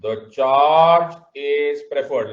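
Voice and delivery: loud, very slow, drawn-out words; emphatic slow recitation; classroom dictation. Shown as a demonstration. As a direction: stretch each word for note-takers.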